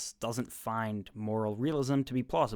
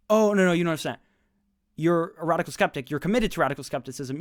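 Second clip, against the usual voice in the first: loud, higher, patronizing mock explanation; mock condescension; 4 semitones higher, 7 dB louder, faster than usual. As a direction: talk down to them like a smug expert, speeding up at the end. Mocking condescending philosopher.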